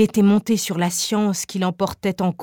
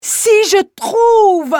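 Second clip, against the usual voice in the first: much higher, much louder, slow ecstatic cry; inspired outburst; 12 semitones higher, 7 dB louder, much slower than usual. Acted. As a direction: peak of rapture, the inspired man. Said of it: "sur trois tons différents".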